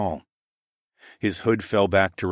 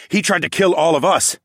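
loud, raised, indignant scoff; 10 semitones higher, 7 dB louder than usual. A narrator's reading. Fighter answers plea to spare mage, scoffing loudly. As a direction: scoff at the very idea. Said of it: "I scoffed".

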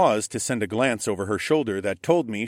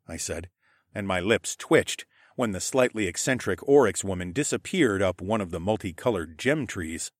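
usual speaking voice

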